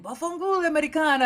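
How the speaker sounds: shopkeeper's mock-friendly jab; mocking butcher. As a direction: fling the greeting at her. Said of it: "he was just like".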